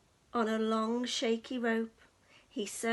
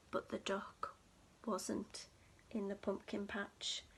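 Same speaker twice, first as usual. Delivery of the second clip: hushed suspense; 5 semitones lower, 11 dB quieter than usual. A narrator's reading. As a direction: drop to a hush for the twist.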